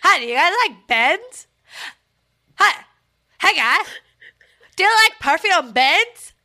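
loud, high Canadian cartoon voice; silly Canadian cartoon voice, giggling; South Park Canadian. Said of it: "the Canadian, accent from South Park".

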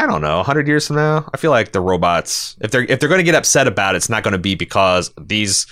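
ordinary talk

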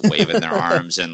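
usual speaking voice

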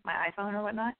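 ordinary delivery

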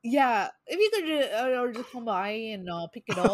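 lightly put-on Midwestern accent; a hint of Minnesota nice. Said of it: "that's me trying to do a Midwestern".

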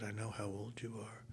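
whisper. Retold or imitated. Older star confiding backstage.

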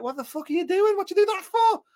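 high, loud mock disbelief; shrill astonished outburst; incredulous crowd. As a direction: yell it like a baffled fan. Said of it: "everyone was like".

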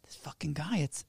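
confused whisper. Retold or imitated. Bewildered clerk muttering to herself, whispered.

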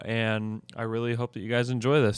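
usual speaking voice

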